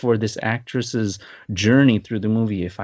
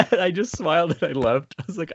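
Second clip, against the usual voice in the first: ordinary talk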